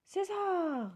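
high sharp call of the name; mild startled cry; father calling out. A narrator's reading. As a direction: call him back in alarm. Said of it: "s'écria son papa".

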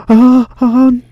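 gasped, high and loud oh no; dismayed gasp. Real, not acted. Player watching all the effort go to waste, dismayed. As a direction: cry out in dismay, high and drawn. Gasps as the game goes wrong.

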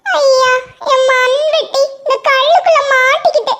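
put-on high child's voice, begging for help; a small boy asking a favour; pleading little boy. Ringu begs the stranger to free his shovel.

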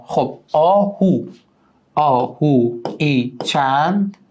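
slow, drawn-out syllables; syllable-by-syllable scansion. Shown as a demonstration. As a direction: stretch each syllable to show the meter. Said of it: "بذارید تختی اینجا انجام بدم".

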